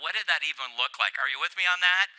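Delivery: normal talking voice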